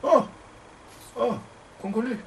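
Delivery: ordinary talk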